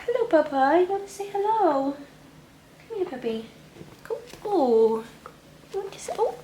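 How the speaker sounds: high cooing greeting to a pet; pet-talk voice. Real, not acted. Vlogger breaks off to greet puppy, high pet-talk. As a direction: coax the dog in a high little voice.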